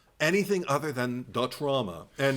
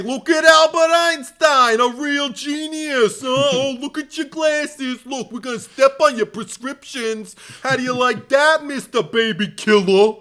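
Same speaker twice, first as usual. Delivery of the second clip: cartoon Bronx bully; 11 semitones higher, 9 dB louder than usual. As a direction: taunt him like a hammed-up Bronx bully. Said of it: "that level of cartoon Bronxishness".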